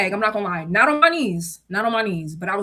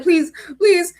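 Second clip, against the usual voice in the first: high, louder pleading please; mock begging; 8 semitones higher, 6 dB louder than usual. Pleading with her boss again, high and loud. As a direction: beg with hands together. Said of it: "I had my hands together".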